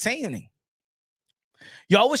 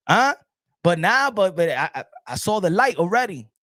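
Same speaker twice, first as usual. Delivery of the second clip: normal talking voice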